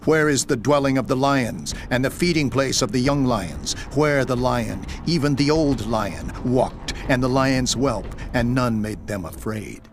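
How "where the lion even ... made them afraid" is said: plain reading voice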